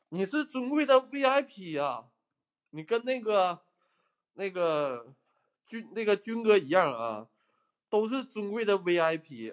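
高八度地热捧贵宾; 拔高嗓子夸张捧场; 高声吹捧. Real, not acted. 主播高声吹捧贵宾观众. (高亢地恭维打赏观众)

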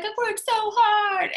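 mock whiny lament. Team member who just missed rank, whiny lament. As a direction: wail it out in exaggerated hurt. Voicing a hurt, frustrated worker.

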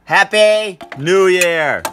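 slow, loud shouted cheer; new year cheer. Real, not acted. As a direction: draw out a big celebratory shout. Cheer after midnight.

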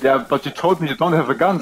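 ordinary talk, nothing marked

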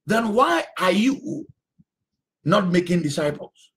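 usual speaking voice

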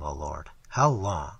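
slow, low, pleading; pleading prayer. A narrator's reading. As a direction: draw it out like a desperate prayer. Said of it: "the prayer in their hearts".

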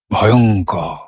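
low, drawn-out word full of feeling; expressive dread. Shown as a demonstration. Teacher shows how the word carries its feeling, expressive dread. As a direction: let ভয়ঙ্কর carry the dread, low. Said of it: "দেখি তো আরেকবার করে".

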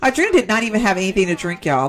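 ordinary talk

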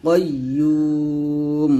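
কাইয়্যুম with a thick back "qo" and long "yuu"; heavy qaf. Shown as a demonstration. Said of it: "তিন আলিফ টান দিয়ে". (কাইয়্যুম-এর কফ মোটা, ইউ টেনে)

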